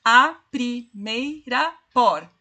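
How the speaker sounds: very slow, each syllable spaced out; slow syllable scansion. Shown as a demonstration. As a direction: tap out the five syllables. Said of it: "cinco sílabas".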